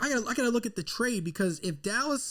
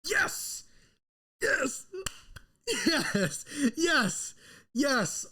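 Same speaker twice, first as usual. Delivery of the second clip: real overjoyed yes, pitched high; excited yelling; 5 semitones higher, much slower than usual. Real, not acted. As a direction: celebrate the pick at full joy. His team drafts Smith.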